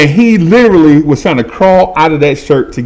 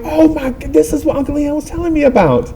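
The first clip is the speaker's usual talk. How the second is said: amazed realization. Retold or imitated. Boy realizing his uncle's advice worked, high amazement. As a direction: go high as it dawns on you.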